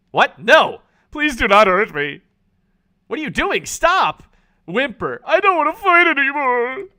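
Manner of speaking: high, loud whimpering plea; pleading zombie. Acted. The dominated zombie begging the player, high frightened plea. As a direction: beg like a cowed monster.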